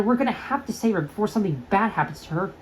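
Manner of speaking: ordinary delivery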